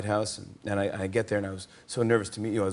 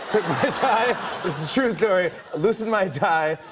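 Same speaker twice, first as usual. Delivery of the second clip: laughing; 8 semitones higher, 4 dB louder than usual. Real, not acted. Laughing through funny story.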